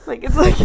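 loud frustrated sigh into laugh; exasperated sigh and laugh. Real, not acted. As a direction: sigh then burst out laughing. Mask breathing frustration.

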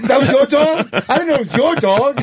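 high, fast, mock-apologetic kangaroo voice; sheepish kangaroo. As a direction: backpedal fast in a high silly voice, like a caught animal.

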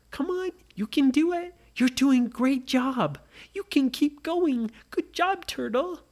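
an eager coach cheering a tiny turtle. Acted. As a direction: cheer a little one across the finish.

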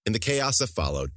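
plain reading voice

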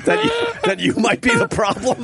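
words pushed out high through real laughter; laughing while teasing. Real, not acted. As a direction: tease him while still laughing.

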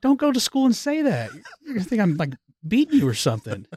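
high-pitched retold dad protest; mock alarm. Dad to daughter after she told teacher, mock alarmed.

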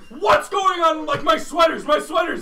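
high, loud confused shout; startled shout. Retold or imitated. Roommate Jason woken up, shouting back in confusion. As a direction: yell back in alarmed confusion. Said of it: "And then Jason's like".

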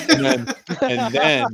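words breaking into giggles; trying to talk while cracking up; laughing. Real, not acted. Cracking up mid-story.

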